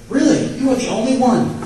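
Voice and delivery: high, loud sarcastic surprise; over-the-top fake astonishment; mock amazement. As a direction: act shocked, dripping sarcasm. Sarcastic reply wished for.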